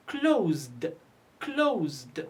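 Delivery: "closed" drawn out, ending in a voiced d; final d sound. Shown as a demonstration. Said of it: "So we pronounce it".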